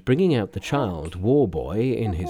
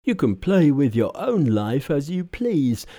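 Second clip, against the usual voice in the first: higher-pitched protest with mild horror; horrified; 6 semitones higher, 3 dB louder than usual. A narrator's reading. Pigsy objecting to risking his life, mildly horrified. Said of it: "horrified at this suggestion".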